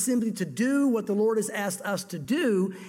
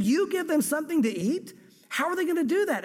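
raised-pitch disbelieving question, rising at the end; stunned, higher retelling of the order; incredulous echo; 6 semitones higher than usual. Retold or imitated. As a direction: repeat it back as if it can't be true. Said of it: "And he's saying".

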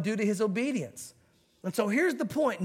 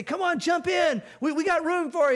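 calling out; 6 semitones higher, 3 dB louder than usual. Acted. Rescuers in boat call out.

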